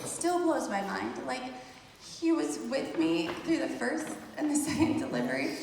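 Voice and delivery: normal talking voice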